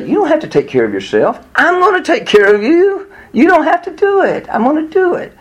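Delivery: high-pitched, emphatic voicing of God's promise; God reassuring. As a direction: insist earnestly that you'll take the burden. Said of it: "He's trying to say".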